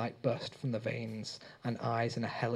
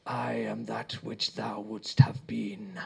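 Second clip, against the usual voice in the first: slow, eerie voice from an unmoving mouth; ringing creature voice; 2 semitones lower, slower than usual. A narrator's reading. The Ocean's Eye announcing itself to Rob, slow and eerie. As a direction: sound like a voice ringing from a throat.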